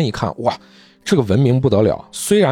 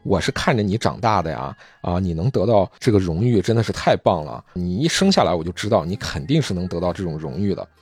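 fatherly doctor; 6 semitones lower than usual. Retold or imitated. Kindly minotaur doctor speaks.

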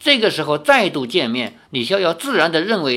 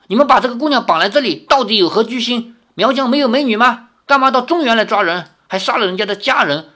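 fast, louder, higher indignant shout; angry retort; 7 semitones higher, 4 dB louder, faster than usual. A narrator's reading. Young hero angrily confronts the Miao chief, fast loud shout. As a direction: demand an answer, furious. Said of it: "怒道".

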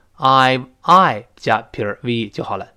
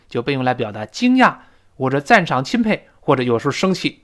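usual speaking voice

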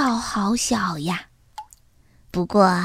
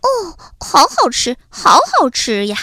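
high, swooping cry of delight at tasty food; happy, emphatic mouse relishing food; 5 semitones higher, 5 dB louder than usual. A narrator's reading. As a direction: exclaim over a yummy bite. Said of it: "它高兴地说".

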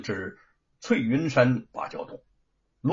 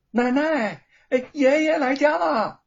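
excited call; 11 semitones higher, 5 dB louder than usual. Acted. Maid announces master home.

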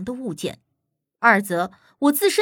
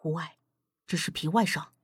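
very soft, low murmur; hushed reassurance; 7 semitones lower, 8 dB quieter than usual. Acted. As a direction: play it down quietly so nobody overhears. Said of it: "轻声道".

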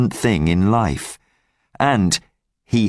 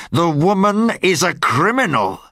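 higher, sharp angry retort; 7 semitones higher than usual. Acted. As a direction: snap back at him in anger. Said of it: "said Javert angrily".

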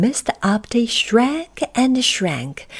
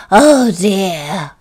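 gasped; 7 dB louder than usual. A narrator's reading. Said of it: "he gasped".

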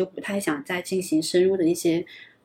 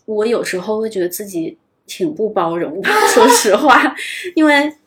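laughing; 3 semitones higher, 7 dB louder than usual. Real, not acted. Self-critical admission with laugh.

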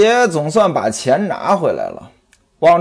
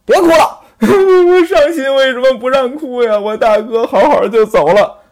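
fake sobbing protest; 8 semitones higher than usual. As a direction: protest through put-on sobs. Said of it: "旁边一位".

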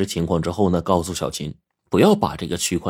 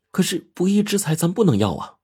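lightly voiced wife, higher pitch; protesting wife; 11 semitones higher than usual. A narrator's reading. Xiaoqin refusing ill-gotten money, mild protest. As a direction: object earnestly in a higher voice.

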